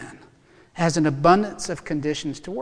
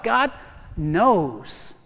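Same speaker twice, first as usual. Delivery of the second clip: usual speaking voice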